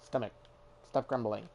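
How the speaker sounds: ordinary talk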